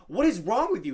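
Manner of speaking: high, loud mock outburst; T-Bone, angry. Player retells villager's reaction to the net attack, angry. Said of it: "he got an angry look".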